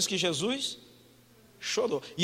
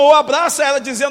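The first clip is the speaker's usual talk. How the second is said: ordinary delivery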